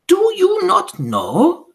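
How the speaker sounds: very slow, high, loud witch shout; Grand High Witch shouting at the audience. Acted. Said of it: "she shouted".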